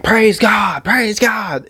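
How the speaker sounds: preacher doing punk rock vocals. Retold or imitated. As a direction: howl it like a punk singer preaching. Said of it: "in, like, a punk rock voice".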